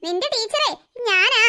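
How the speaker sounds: ordinary talk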